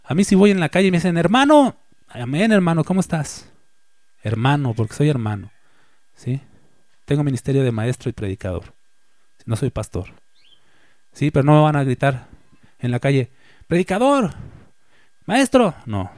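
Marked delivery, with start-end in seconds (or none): 1.2-3.5 street greeting called out. Retold or imitated. Imitating people greeting in street. 13.7-15.7 imagined street shout. Retold or imitated.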